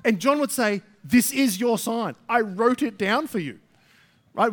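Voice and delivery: loud, high, insistent preacher; fervent preaching. Acted. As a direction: drive it home from the pulpit. Preaching at full force.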